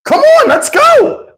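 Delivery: very high, loud, slow drawn-out hurry-up; mock impatient cashier. Cashier hurrying Steve out of line, loud high mock call. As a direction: mimic the cashier rushing him, loud and high. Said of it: "the lady say".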